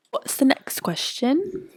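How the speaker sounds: British accent. Shown as a demonstration. Co-host moves the show along, in a British accent. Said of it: "I can do a British accent".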